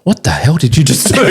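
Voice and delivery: laughing astonished sales rep; amazed sales rep. Retold or imitated. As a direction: laugh out the rep's shock. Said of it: "my sales rep's going".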